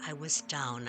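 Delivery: ordinary delivery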